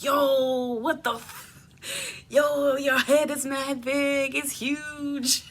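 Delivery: high, stretched-out mock disbelief; imitated astonishment. Family checking on the swollen face, imitated astonishment.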